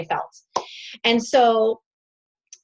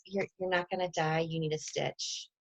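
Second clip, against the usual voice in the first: doctor; 3 semitones lower, 11 dB quieter than usual. Retold or imitated. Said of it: "the doctor goes".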